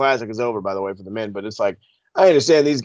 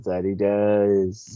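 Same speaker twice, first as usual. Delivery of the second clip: deeper, slower repeat; 5 semitones lower, 4 dB quieter, much slower than usual. Pretend. Repeated even lower, slower.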